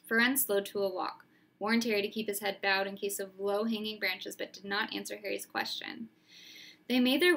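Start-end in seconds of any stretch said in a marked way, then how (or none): none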